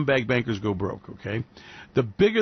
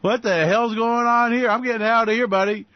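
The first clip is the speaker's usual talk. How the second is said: mock panicked exec; 6 semitones higher, 3 dB louder than usual. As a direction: act the exec bailing out in alarm. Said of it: "it's like".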